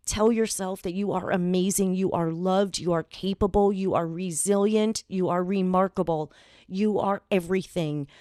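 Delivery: ordinary talk, nothing marked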